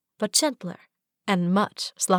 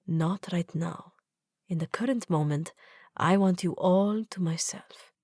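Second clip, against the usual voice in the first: tender whisper; 4 dB quieter than usual. Acted. Zahid tender, holding her.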